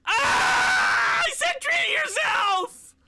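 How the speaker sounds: high, loud, slow howl of despair; anguished shout. Real, not acted. Gamer screaming at his collapsing team, anguished howl. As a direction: scream it like it all fell apart.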